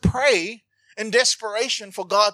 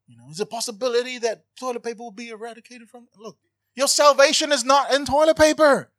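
ordinary talk

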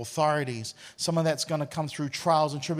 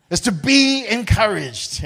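preaching emphasis; 6 semitones higher, 8 dB louder, slower than usual. Acted.

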